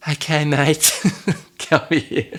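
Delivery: soothing cousin. Retold or imitated. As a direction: coax a shaken mate over with a chuckle. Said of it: "he's like".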